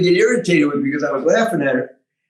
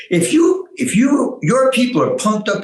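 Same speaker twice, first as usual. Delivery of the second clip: excited; 6 semitones higher than usual. Real, not acted. Fired up about his people.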